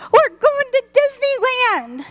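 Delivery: put-on the girl yelling her news to her mom; screaming child. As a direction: shriek it out with joy.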